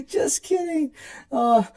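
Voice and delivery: high, giggling through the words; still laughing. Acted. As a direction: tease her sister while laughing. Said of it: "burst out laughing".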